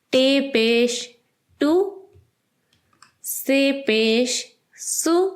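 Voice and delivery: each syllable drawn out slowly and evenly; slow phonics drill. Shown as a demonstration. Teacher sounding out letters slowly for young learners. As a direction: spell it out syllable by syllable for a beginner. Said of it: "اب ہم حروف کی آوازوں کو پیش کے نشان کے ساتھ پڑھتے ہیں".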